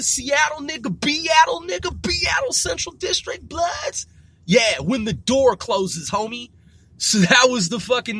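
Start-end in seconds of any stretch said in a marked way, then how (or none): 0.0-4.1 gang shout-out. Pretend. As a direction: call out the set with pride.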